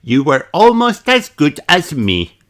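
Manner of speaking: put-on high, loud boastful little girl; Peppa's voice. A narrator's reading. Peppa boasts to Pedro, cheekily.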